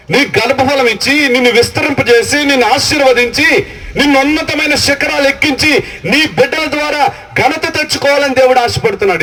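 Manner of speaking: high, ringing build of promises; fervent preaching. Acted. Pastor promising childless believers God's blessing, fervent preaching.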